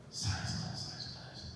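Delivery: very soft, repeated to himself; baffled muttering. Retold or imitated. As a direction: puzzle over the words under your breath. Puzzles over the quoted words.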